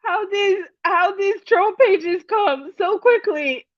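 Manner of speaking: high and slow, words half lost in laughter; laughing. Real, not acted. Host marvels at chat's troll pages, talking through laughter. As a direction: try to talk through the giggles.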